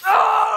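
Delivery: loud, sudden scream of alarm; jump-scare yelp; startled scream. Real, not acted. Gamer scared by the monster, sudden loud scream.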